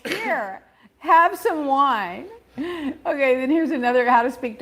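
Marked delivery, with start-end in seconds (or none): 0.1-2.3 drawn out, deadpan delivery; slow punchline. Shown as a demonstration. Host delivering joke punchline to cohost, slow and deadpan. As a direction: stretch the wine line for the laugh. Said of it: "punchline read slowly".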